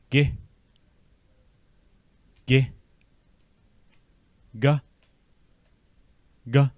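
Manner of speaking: "Mi" "No" "Gi" "Ga" "Jeb" each said twice, crisp; each syllable clipped and separate. Shown as a demonstration. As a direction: break the word into parts for repetition. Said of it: "Let's break this word apart".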